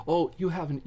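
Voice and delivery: ordinary delivery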